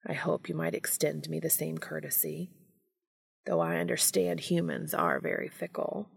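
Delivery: soft, intimate murmur; hushed vow. A narrator's reading. Castiel promises himself to Dean, murmuring. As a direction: pledge it in a hushed undertone.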